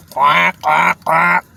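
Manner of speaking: mock duck quacking; duck quacks. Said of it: "It's a fucking duck".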